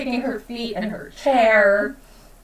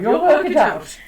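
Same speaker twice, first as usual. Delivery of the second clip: usual speaking voice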